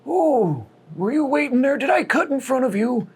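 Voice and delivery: high, thin put-on old man voice; sweetly flustered old man, pitched high; apologetic old man. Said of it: "he looks over and is like".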